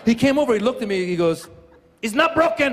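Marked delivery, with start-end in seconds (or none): 2.0-2.7 high, hammed-up Mexican accent; Mexican referee. Mexican referee to injured player, high Mexican accent. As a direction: imitate a Mexican referee's confident verdict. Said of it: "the first person over was the Mexican referee".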